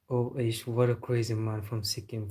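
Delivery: normal talking voice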